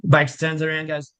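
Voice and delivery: normal talking voice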